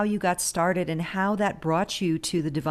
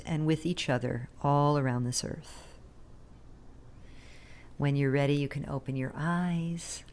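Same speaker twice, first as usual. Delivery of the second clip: guided meditation. Acted. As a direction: ease listeners gently into each breath. Leads a guided breathing meditation.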